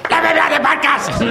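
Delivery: loud, high begging squeal; hammed-up desperate plea; mock pleading. Co-host begs to be on the podcast, mock pleading.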